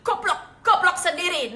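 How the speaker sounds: high, loud mock quarrel; retorting insult. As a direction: shout the insult straight back. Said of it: "dimaki-maki".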